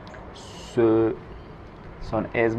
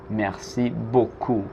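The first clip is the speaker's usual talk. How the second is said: slow, careful Merci beaucoup; slow model; 3 dB quieter, slower than usual. Shown as a demonstration. Teacher introducing Merci beaucoup slowly to students. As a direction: say it slowly for learners to copy. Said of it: "teacher models new words".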